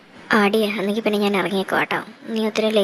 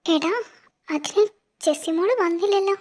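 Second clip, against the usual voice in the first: worried high plea; 7 semitones higher than usual. Acted. Mother worried daughter not home.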